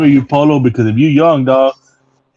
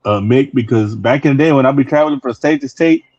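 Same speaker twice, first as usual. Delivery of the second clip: ordinary talk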